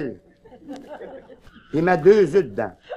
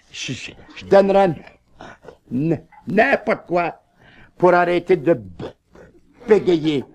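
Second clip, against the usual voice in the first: stuttering; 3 semitones lower than usual. Acted. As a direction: stutter out each word painfully. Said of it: "et puis bégayant".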